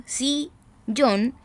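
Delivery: sillón in two separate beats, si-llón; split into syllables. Shown as a demonstration. Teacher showing sillón has two syllables, beat by beat. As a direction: pause between the si and llón of sillón. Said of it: "que tiene dos sílabas".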